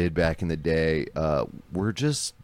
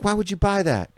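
higher mocking scold; high indignant wife; 13 semitones higher than usual. Wife scolding husband over Vespa, high scolding. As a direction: scold him for the scooter. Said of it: "He's like".

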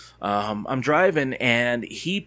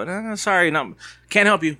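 higher, slightly louder polite refusal; retold brush-off; 7 semitones higher, 5 dB louder than usual. Driver turning away a stranger at his window, brushing them off. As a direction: imitate yourself waving off a stranger.

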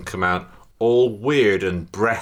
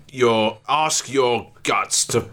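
slow, princely-sounding Cockney; 3 semitones higher, much slower than usual. Acted. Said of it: "Sound like a prince".

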